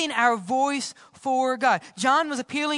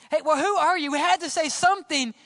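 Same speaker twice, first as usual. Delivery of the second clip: higher-pitched, pressing demand; insistent questioning; 6 semitones higher, 4 dB louder, faster than usual. Acted. Delegation needing an answer from John, insistent. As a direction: push urgently for an answer.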